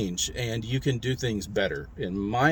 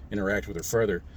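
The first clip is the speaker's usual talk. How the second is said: usual speaking voice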